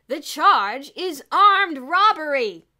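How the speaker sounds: very loud, slow, stern proclamation; loud announcement. A narrator's reading. Police officer to the crowd, loud and stern. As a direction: announce it for all to hear. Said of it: "replied in a loud voice".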